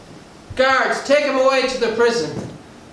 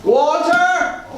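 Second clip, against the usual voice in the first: calling out; 5 semitones higher, much slower than usual. Acted. Father calling son in.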